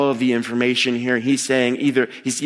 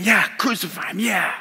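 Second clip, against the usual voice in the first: mock crowd shout; 7 semitones higher than usual. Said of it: "the crowd starts saying".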